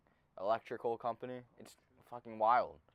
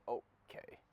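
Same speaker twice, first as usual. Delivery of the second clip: mocking whispered okay; 7 dB quieter than usual. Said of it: "No, you said".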